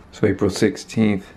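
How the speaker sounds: ordinary talk, nothing marked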